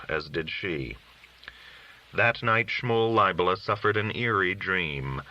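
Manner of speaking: ordinary delivery, nothing marked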